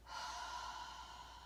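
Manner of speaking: long voiceless huffing exhale; open-mouthed huffing breath; demo huff. Shown as a demonstration. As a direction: breathe out as if steaming up a mirror. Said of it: "huffing like you're, you're steaming up a mirror".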